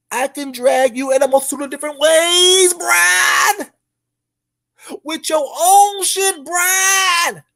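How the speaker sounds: high shouting rant; yelling. Acted. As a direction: yell it at him high. Yelling at Brian.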